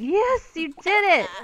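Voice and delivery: high, loud, drawn-out praise; delighted cheering. Real, not acted. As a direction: celebrate a little one's success. Cheering on the game's child.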